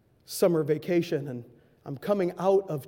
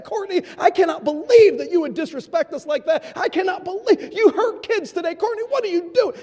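scolding shout; 11 semitones higher, 7 dB louder than usual. Retold or imitated. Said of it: "the whole way I said".

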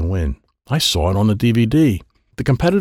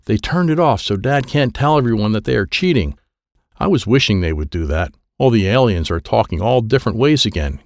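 usual speaking voice

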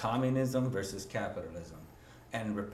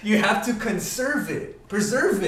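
loud, fired-up argument; passionate; 7 semitones higher, 10 dB louder than usual. Real, not acted. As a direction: hammer the point home, loud and high. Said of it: "getting so passionate in here".

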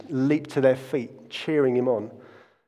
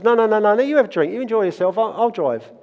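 higher-pitched, mock-polite protest; playful insisting; 6 semitones higher than usual. Re-enacting a couple tussling over who drives, playful insisting. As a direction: insist over the other person, in a lifted voice.

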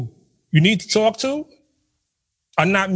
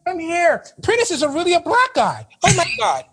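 much higher, gushing and astonished; 6 semitones higher than usual. Retold or imitated. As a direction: gush to a colleague in disbelief. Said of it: "the accent is, oh, it's marvelous".

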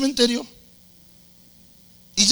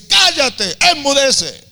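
high, loud rebuke to the storm; Jesus silencing the tempest, loud high command; commanding shout; 7 semitones higher, 4 dB louder, slower than usual. Retold or imitated. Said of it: "lo veo gritándole a la nada".

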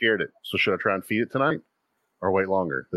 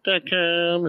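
imitating a commercial tagline; 11 semitones higher than usual. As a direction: squeal it like a cheesy commercial ending. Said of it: "and you're like".

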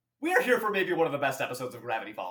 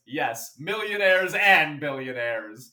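rich dad voice; 6 dB louder, slower than usual. Retold or imitated. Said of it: "And he replies".

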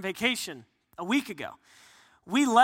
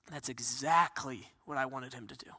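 low, hushed devil voice; much lower and softer, a secret gloat; devil's thoughts; 8 semitones lower, 8 dB quieter than usual. Acted. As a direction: scheme quietly as the devil, pleased with the plan. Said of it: "the devil is thinking".

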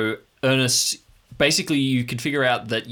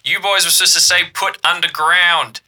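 loud radio call; 7 semitones higher, 8 dB louder than usual. Acted. GM voicing radio caller.

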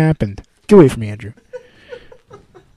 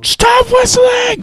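high laughing shout; 5 dB louder, much slower than usual. Real, not acted. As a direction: yell it through laughter. Shouting through laughter at whistler.

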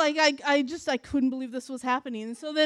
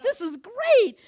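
imitated friends gushing, pitched up and louder; excited gushing; 6 semitones higher, 5 dB louder, slower than usual. Friends gush to her in the cab, excited. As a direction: gush with a big swoop on the praise.

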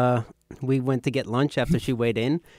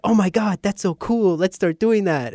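put-on high, loud, awed kid enthusiasm; imitated childhood excitement; 8 semitones higher, 7 dB louder than usual. Brothers watching Power Rangers, mock-excited. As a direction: gush like kids inspired by a TV show.

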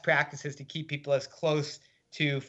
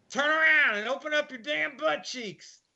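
much higher, gruff Kentucky drawl order; Kentucky drawl guard; 13 semitones higher than usual. Retold or imitated. Gruff guard ordering the strip search in Kentucky drawl. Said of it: "spoke gruffly in a thick Kentucky drawl".

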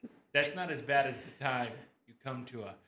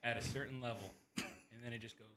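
trying to be quiet; 9 dB quieter, faster than usual. Real, not acted. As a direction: hold the voice down on purpose. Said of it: "trying so hard to be quiet right now".

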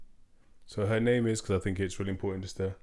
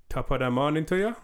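mock Jamaican accent, pitched higher; 6 semitones higher, 3 dB louder than usual. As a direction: put on a playful, badly faked Jamaican accent. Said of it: "let's do Jamaican accent".

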